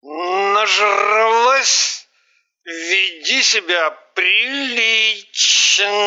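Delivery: slow, superior scolding; condescending. A narrator's reading. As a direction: talk down to her slowly. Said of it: "с чувством превосходства".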